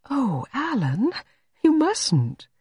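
high, drawn-out, meek protest; dismayed protest. A narrator's reading. Jane stops Everard throwing out her vase, dismayed. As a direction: plead in high-voiced dismay.

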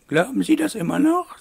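high, loud lady's voice; questioning mademoiselle. A narrator's reading. Aglaé asks whether Wolf still believes it, high challenging lady voice. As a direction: challenge him in a high character voice.

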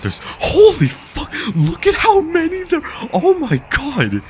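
very high, slow astonished squeal; stunned amazement. Real, not acted. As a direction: gasp it out in disbelief. Amazed at huge army.

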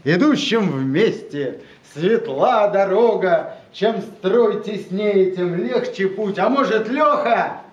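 declaiming a poem to a live audience; dramatic poetry declamation. Acted. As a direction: recite the verse with weight and rhythm. Poet reads own poem aloud.

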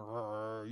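low, quiet growl; imitated dog growl. Preacher playing the dog's reply, low soft growl. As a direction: rumble softly like a warning dog.

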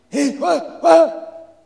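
put-on high muffled old man voice; mumbled through mask. A narrator's reading. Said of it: "mumbled the insulator through his mask".

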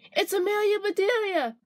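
put-on high, sing-out child's greeting; excited child. A narrator's reading. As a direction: announce a favorite visitor in a high, gleeful kid voice. Children burst out to greet their sitter.

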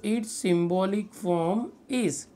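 very slow, drawn-out phrase; dictating. Real, not acted. Teacher has students write the heading, dictating slowly. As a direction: dictate each word separately.

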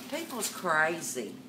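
ordinary talk

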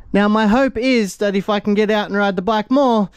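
usual speaking voice

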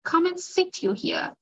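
father urging the guest to take his chair; cried out. Acted. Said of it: "he cried".

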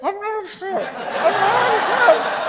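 high, loud, laughing squeal; squashed in a hug, squealing in protest through laughter. Retold or imitated. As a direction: squeal it out while being crushed in a hug. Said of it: "And I'm saying".

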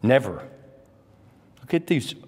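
usual speaking voice

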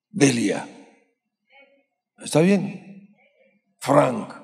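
ordinary talk, nothing marked

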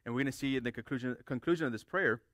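ordinary delivery

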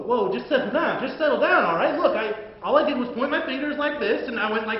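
usual speaking voice

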